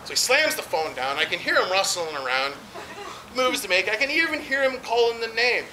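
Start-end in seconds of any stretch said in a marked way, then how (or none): none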